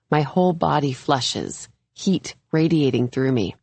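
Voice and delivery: ordinary delivery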